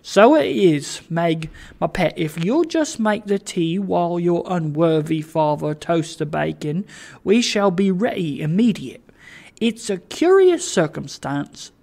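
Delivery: put-on lively old father's character voice; Trotty, kindly fussing host. Father busy with tea for his guests, cheerily fussing. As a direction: play a chatty, fussing father with swooping emphasis.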